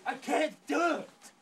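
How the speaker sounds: drawn-out mock wail of effort; straining wail. Character strains and fails to rise.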